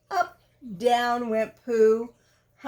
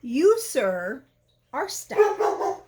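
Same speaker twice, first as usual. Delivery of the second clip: pompous owl; 2 semitones higher, 3 dB louder, slower than usual. Acted. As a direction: pronounce it like a solemn verdict. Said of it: "he said".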